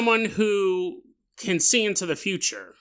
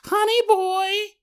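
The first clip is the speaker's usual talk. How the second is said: high, slow sing-song mother voice; creepy alien mimicking a mother; eerie mother's call; slower than usual. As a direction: lure him out like a sweet mom.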